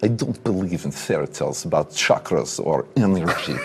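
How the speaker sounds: put-on heavy Russian accent, words pressed out; a stern Russian villain in a mock Russian accent. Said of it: "You wanna do Russian?".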